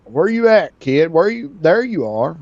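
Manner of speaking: high, loud calling out. Real, not acted. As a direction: call across the map to find him. Calling out for a player.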